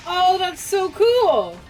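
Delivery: ordinary talk, nothing marked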